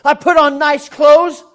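loud, high mock outrage, building; acted indignation. Man told by surgeon he's unclean, acted indignation. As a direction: protest hotly as if insulted. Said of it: "I could be very indignant and say".

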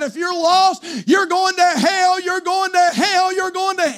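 high, drawn-out mock preacher yell; imitated hellfire yelling. Young preacher boy yelling at the lost, imitated hellfire rant. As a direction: hammer it over and over like a screaming preacher. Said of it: "just yelled and screamed".

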